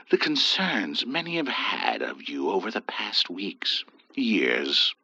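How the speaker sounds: hushed concern. A narrator's reading. Priest quietly voicing the neighbours' worries, whispered. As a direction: breathe it out discreetly. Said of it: "the priest explained".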